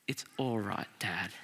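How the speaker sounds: put-on soft, hushed comfort from a child; quiet tender comforting; gentle reassurance. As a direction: breathe it tenderly. Child comforting his father.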